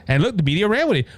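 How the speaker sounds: ordinary talk, nothing marked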